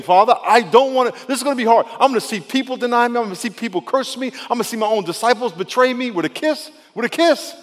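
high, loud anguished plea to the Father; acted distressed prayer, pitched well up; Jesus. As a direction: plead urgently, piling up each dreaded hurt. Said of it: "Jesus is going through the same thing".

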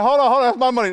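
loud mock protest, hands on wallet; playful outcry at the idea of giving. Preacher mocking a stingy churchgoer, loud mock protest.

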